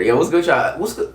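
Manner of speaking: ordinary talk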